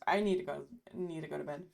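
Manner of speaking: usual speaking voice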